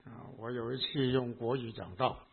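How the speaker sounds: ordinary delivery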